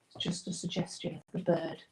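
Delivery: whispering. Real, not acted. Muttering while blending.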